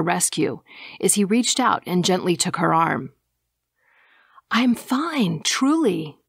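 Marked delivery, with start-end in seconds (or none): 4.5-6.2 higher-pitched, mildly insistent protest. A narrator's reading. Woman refusing a stranger's escort, insistent. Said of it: "she insisted".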